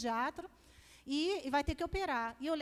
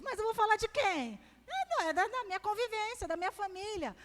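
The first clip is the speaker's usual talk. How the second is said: playful protest; 7 semitones higher than usual. Retold or imitated. Mother answering children who object, high mock protest. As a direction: plead your case in a high comic voice.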